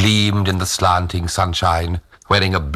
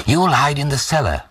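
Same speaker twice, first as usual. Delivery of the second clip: put-on higher-pitched order from the old man; lightly voiced old man; 8 semitones higher than usual. A narrator's reading. Old Tag tells his daughter-in-law to hide, higher-pitched instruction.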